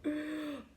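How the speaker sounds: put-on soft old chuckle; tortoise chuckle. A narrator's reading. Tortoise amused by Danny, soft chuckle. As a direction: chuckle quietly. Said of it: "The tortoise laughed".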